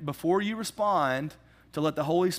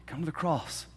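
softer, gentle come to the cross; tender invitation; 3 semitones lower, 7 dB quieter than usual. Retold or imitated. Holy Spirit to the ashamed sinner, a softer tender invitation. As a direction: invite softly, like reaching out a hand. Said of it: "felt the Holy Spirit say".

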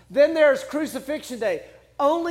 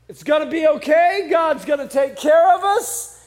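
louder, higher public reassurance; spin mode; 4 semitones higher, 6 dB louder than usual. Acted. Moses reassuring Israel as Pharaoh's army bears down, in loud spin mode. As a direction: put on a loud, upbeat administrator's spin. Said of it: "goes into spin mode".

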